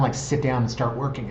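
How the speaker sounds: usual speaking voice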